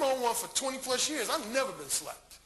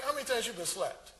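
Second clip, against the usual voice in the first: ordinary talk, nothing marked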